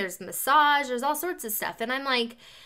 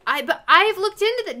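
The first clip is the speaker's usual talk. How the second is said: high, loud protest; indignant pushback at husband; heated protest; 8 semitones higher, 6 dB louder than usual. Retold or imitated. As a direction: push back hard, voice up. Heated retold argument with husband.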